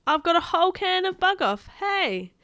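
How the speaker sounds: high, loud taunting call; yell. A narrator's reading. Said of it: "I yelled".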